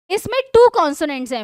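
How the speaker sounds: ordinary delivery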